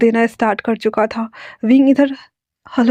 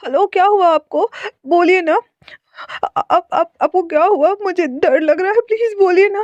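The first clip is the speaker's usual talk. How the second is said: much higher, frantic near-crying plea; frightened tearful pleading; 7 semitones higher than usual. Acted. Caller panics at a scream on phone, high tearful plea. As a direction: voice climbing in panic, begging him to speak. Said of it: "उसकी रोने वाली आवाज़".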